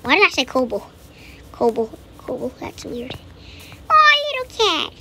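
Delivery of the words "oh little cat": cooing at a cat. Real, not acted. Spots a cat in game.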